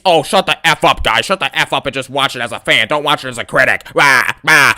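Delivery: mocking put-on voice of a wrestler telling fans to hush; Liv Morgan impression. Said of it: "that's how Liv Morgan sound".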